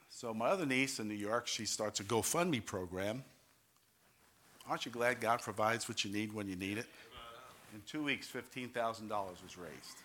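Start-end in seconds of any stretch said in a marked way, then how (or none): none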